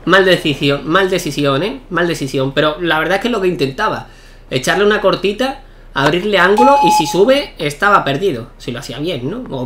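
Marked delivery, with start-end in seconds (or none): none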